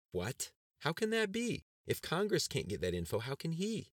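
like she's just been bitten by a snake; stunned disbelief. A narrator's reading. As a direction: pitch leaps high in shocked disbelief. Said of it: "not believing her ears".

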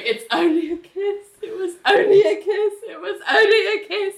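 high, repeated wail, close to laughing; mock frantic self-reassurance. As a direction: chant it like banging your head on a door. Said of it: "banging her head on the door going".

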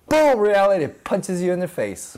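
ordinary delivery

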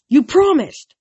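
loud, high outcry; indignant protest. A narrator's reading. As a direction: burst out in protest at being cheated. Hero protests a broken deal.

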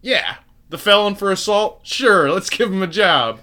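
sarcastic mock voice. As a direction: play it as mock praise, dripping with irony. Mocking MIT's hiring of a felon.

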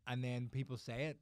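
ordinary delivery

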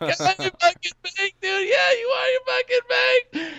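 very high squeaky stoner voice; squealing tease. Retold or imitated. Friends telling O he is baked, squealing tease. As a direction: imitate buddies squealing in hysterics.

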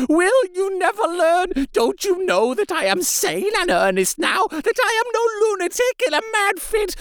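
high, frantic begging through tears; desperate tearful pleading. A narrator's reading. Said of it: "poured forth a torrent of entreaty".

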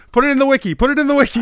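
laughing excitement. Real, not acted. GM laughing, joking.

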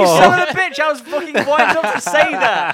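shouting, half laughing; loud mock outrage. Real, not acted. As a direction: yell it in fake indignation. Mock outrage at being beaten.

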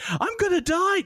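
hammed-up terrified cry; mock panic. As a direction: scream it as the odds get worse. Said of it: "that just jumped to twenty".

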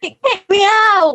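indignant exclaim. Real, not acted. Rejoins after dropping off call.

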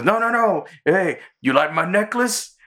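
squeaky deflecting charm; Carl's voice. Retold or imitated. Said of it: "He goes".

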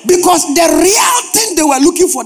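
loud, high preacher's shout; preaching shout. Real, not acted. Pastor shouting at his congregation, loud and high. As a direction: hammer the question at the crowd.

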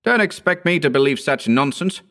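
indignant disbelief; disgusted exclamation. A narrator's reading. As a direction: dismiss it with disgust. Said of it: "he exclaimed disgustedly".

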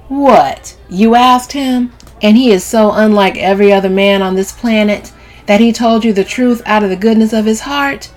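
loud, high sarcastic tirade; scoffing, animated rant; incredulous Camille, mocking. A narrator's reading. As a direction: pile on the disbelief, ending dismissively.

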